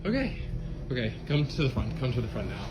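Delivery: quiet, nervous repeated okays; hushed. Real, not acted. Hushed after the scare.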